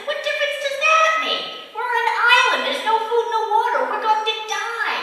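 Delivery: high, loud exasperated yell; frantic castaway shouting at his calm friend; panicked shout. Acted. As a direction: yell it in disbelief. Panicked man shouting back.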